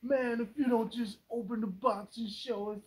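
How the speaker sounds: mimicking T-Man's impatience, high and loud; impatient friend. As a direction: nag like an impatient buddy. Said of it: "he be hella impatient".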